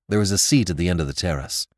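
ordinary delivery, nothing marked

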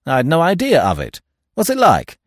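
high, loud surprise; very surprised. A narrator's reading. Stoner learning of a second will, very surprised. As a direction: react loudly, pitch jumping up. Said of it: "Stoner was obviously very much surprised".

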